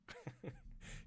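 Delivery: high, quiet giggle; amused giggle. Real, not acted. Storyteller tickled by the flummoxed boss, high giggle. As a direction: giggle while telling the story.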